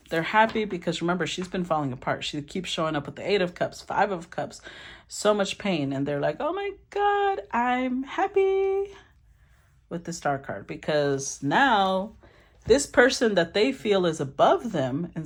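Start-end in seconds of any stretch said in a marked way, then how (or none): 6.4-8.9 very high, drawn-out gushing; mock elation. Partner delighting in her pain, mock elation. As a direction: stretch it out in an exaggerated happy squeal. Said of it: "they're happy right now".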